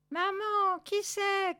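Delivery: high little chick voice; crying-out chick. A narrator's reading. Chick asks mother about the cats, crying out. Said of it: "cria le poussin en montrant les chats".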